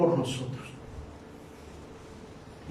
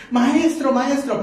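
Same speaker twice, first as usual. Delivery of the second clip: excited calling; 8 semitones higher, slower than usual. Acted. Disciples calling out.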